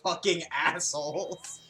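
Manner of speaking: slow, deliberate scolding; slow outrage. Real, not acted. Friend scolding the others over the fic, slow and appalled. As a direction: spell it out slowly, appalled.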